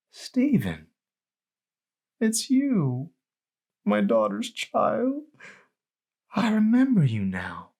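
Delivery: put-on high, slow, wondering old-woman voice; a frail grandma slowly placing a face; old grandmother remembering. A narrator's reading. Grandma recognizing her daughter's child, high and wondering.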